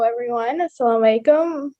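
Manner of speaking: ordinary talk, nothing marked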